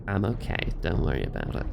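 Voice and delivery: raspy, low chain-smoker voice; hoarse and gravelly today; smoker's rasp. Real, not acted. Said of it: "I just sound like a chain smoker today".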